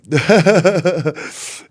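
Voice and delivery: loud high burst of laughter. Real, not acted. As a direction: laugh along at the friend's joke. Laughs with friend.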